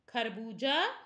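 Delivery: usual speaking voice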